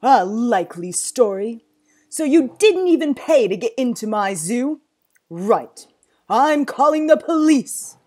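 very loud, scornful authority; angry disbelief. Acted. Zoo owner catching a non-payer, angry disbelief. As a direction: build from scorn to loud threat.

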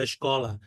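escola starting with a SH sound; Portuguese SH onset. Shown as a demonstration. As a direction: say escola as shko-la. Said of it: "পর্তুগিজে বলে". (escola, শুরুতে শ ধ্বনি)

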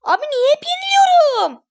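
high-pitched frustrated complaint; exasperated wail. Real, not acted. Gamer asks why they always lose, exasperated wail.

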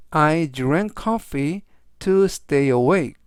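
very slow, clear English sentence; slow example reading. Shown as a demonstration. Teacher reading the sentence slowly for students. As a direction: read it slowly for learners to repeat. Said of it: "teacher reads example sentence slowly".

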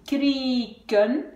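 KRIEKEN coupé en «krie-ken», le I allongé; séparer KRIEKEN en deux morceaux tenus; syllabes séparées. Shown as a demonstration. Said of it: "Même si je sépare ici". (KRIEKEN découpé, voyelle longue)

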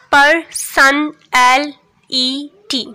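पर, सन, एल, ई, टी said very slowly, each one apart; syllable by syllable. Shown as a demonstration. Teacher counting syllables aloud for students, very slow split. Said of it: "इसमें five number of syllables हैं".

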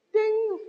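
high bell ding; ding sound. Retold or imitated. Scoring sound effect.

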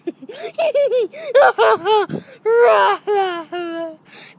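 loud, high, wobbling whoops; long manic noise burst; wild yelling cackle. Acted. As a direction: let out silly crazed laughing howls. Acting out sitting down.

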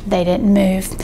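plain reading voice

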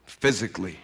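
low, soft and deliberate; quiet resolve. Retold or imitated. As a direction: say it softly, with quiet conviction. Quiet firm reply to crying coach.

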